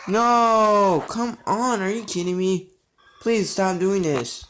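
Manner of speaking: exasperated, high-pitched begging; frustrated pleading. Real, not acted. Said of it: "I'm getting frustrated every time".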